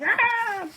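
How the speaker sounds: high, loud mock scream of shock. Storyteller voicing Otama hearing of a death, high scream. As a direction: scream out as the horrified girl. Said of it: "I do my voices".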